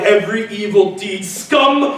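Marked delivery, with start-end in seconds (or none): none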